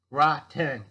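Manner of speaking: a sudden loud punch on Rotten; Rotten thrown out loud and hard; loud repeat. Real, not acted. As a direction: bark the word Rotten out to drive it home. Repeating the key word forcefully.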